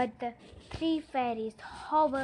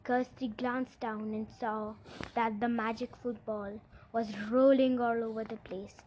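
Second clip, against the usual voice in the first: ordinary delivery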